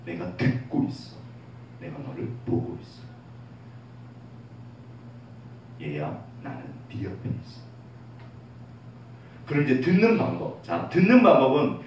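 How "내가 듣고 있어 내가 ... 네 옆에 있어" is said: God answering a prayer in a gentle whisper. Pretend. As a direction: breathe it like a loving father close by. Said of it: "하나님이 응답하세요".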